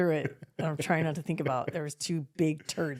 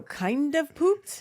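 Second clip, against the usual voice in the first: incredulous; 8 semitones higher, much slower than usual. Retold or imitated. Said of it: "I go".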